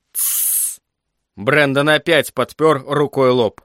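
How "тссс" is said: soft, voiceless shush; shushing hiss. A narrator's reading. Father interrupting his son mid-word, shushing. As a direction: cut him off with a soft shush.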